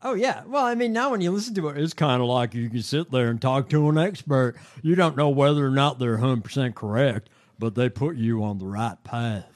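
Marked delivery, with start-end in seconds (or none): 1.8-4.5 mock Southern senator accent, Alabama style; Tuberville's Alabama accent. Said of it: "That's what he sounds like". 4.8-9.5 mock Southern senator accent, Alabama style; Tuberville's Alabama accent. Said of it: "That's what he sounds like".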